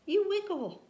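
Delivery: big mouse fussing at the little one; grumpy complaint. Acted. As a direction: tease him for wriggling.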